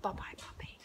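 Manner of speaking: whispered bye-bye puppy. Shown as a demonstration. Trainer whispering goodbye to her dog. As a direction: breathe "Bye-bye, puppy" softly. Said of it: "I say to him".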